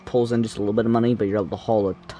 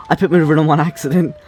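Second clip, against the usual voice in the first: excited; 5 semitones higher, 7 dB louder, much faster than usual. Real, not acted. Repeats after catch.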